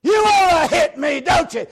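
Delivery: screaming, slow taunt; drill sergeant shout. Retold or imitated. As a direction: taunt the recruit at full volume. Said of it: "He said".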